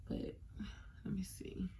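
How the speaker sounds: whispered to herself while fumbling; whispering while looking for the shirt; whispered aside. Real, not acted. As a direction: murmur it under your breath. Muttering while holding shirt up.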